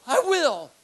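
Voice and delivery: loud, high mock-indignant burst; retold angry retort. Visitor retorting as he leaves, loud mock indignation. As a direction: fire it back loud and high. Retold man snapping back.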